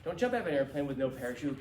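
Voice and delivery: normal talking voice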